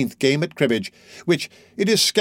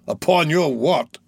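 slow, scornful question; disgusted growl; 4 semitones higher, slower than usual. Acted. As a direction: sneer it back at him. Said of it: "growled Sikes, with excessive disgust".

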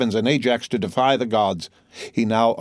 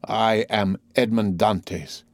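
each word weighted, very slow; slow solemn reveal; much slower than usual. Acted. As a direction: let the name land heavily. The Count reveals his identity.

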